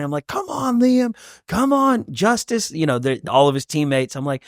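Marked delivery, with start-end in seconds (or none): 0.3-2.7 high-pitched dad cheering from the stands; imitated sideline holler, pitched way up; retold courtside shouting. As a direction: urge the players on in a high, mock yell. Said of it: "so I'm shouting".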